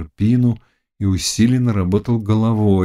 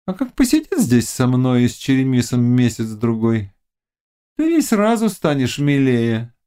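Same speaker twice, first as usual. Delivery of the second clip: higher-pitched witch giving blunt advice; witch Gorpyna, coaxing; 6 semitones higher than usual. A narrator's reading. Witch advising the Cossack about his captive, coaxing.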